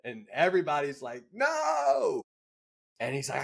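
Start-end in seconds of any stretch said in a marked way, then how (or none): 1.3-2.2 very high, drawn-out "no"; high-pitched retold shout; mock horrified cry. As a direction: imitate everybody crying out in protest. Whole crew objects in story.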